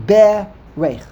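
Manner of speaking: "berech" as BE-rech, first syllable loud; accent on the bays. Shown as a demonstration. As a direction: punch the opening BE of "berech". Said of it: "the accent would be on the bays".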